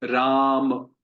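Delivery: राम with the aa drawn out; long राम. Shown as a demonstration. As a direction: stretch the aa in राम. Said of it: "बड़ा अक्षर है तो हम उसको खींच रहे हैं".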